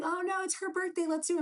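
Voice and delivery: high, breezy imitation of the ex; hammed-up carefree boyfriend; mock carefree ex. Ex pushing shots, retold.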